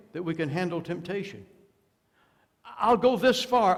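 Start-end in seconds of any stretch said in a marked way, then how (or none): 2.6-3.8 high, loud mocking quote; mock self-assurance. Preacher mocking the one who flirts with temptation, loud and high. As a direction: toss it off like a careless sinner.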